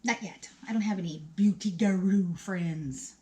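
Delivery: ordinary talk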